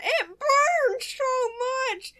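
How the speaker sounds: mock wail. Burnt garlic bread dismay.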